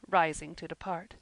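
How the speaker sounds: ordinary delivery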